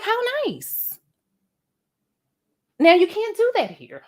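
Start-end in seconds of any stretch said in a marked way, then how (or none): 0.0-1.0 much higher, prim and sweet; mock polite, much higher. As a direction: put on a dainty little voice. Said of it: "I was like".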